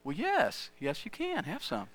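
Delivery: put-on high, teasing old grandfather voice; grandfather's voice. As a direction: play the sly grandpa egging a kid on. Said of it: "he's like".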